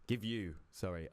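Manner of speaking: normal talking voice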